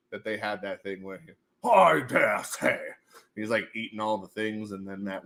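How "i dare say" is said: slow, put-on stuffy gentleman; mock posh walrus. Streamer quoting the walrus in a slow posh voice. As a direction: drawl it like a pompous walrus.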